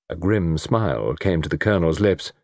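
plain reading voice

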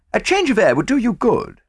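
put-on breezy, persuasive young Englishman; Hastings, the narrator's friend. A narrator's reading. Hastings speaking to Poirot.

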